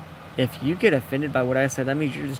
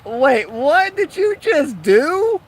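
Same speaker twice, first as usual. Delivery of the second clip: high, slow, drawn-out startled voice; startled question; 12 semitones higher, 6 dB louder, much slower than usual. Retold or imitated. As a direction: stretch it out, eyes wide with shock. Friend reacting in retold story.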